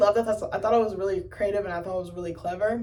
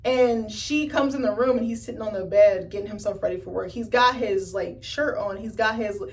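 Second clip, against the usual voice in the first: ordinary delivery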